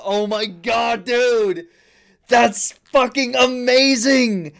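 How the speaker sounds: normal talking voice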